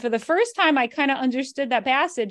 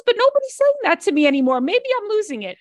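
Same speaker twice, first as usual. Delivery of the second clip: mother's voice, pitched higher and a little louder; voicing her mother; 11 semitones higher, 4 dB louder than usual. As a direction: play her chatty mother, up high and fast. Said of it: "She goes".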